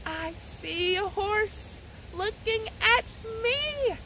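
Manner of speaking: slow, lilting storytime reply; sing-song answer line. Shown as a demonstration. Librarian answering the poem's question for kids, slow sing-song. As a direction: sing out the answer like a nursery rhyme. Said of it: "put that all together".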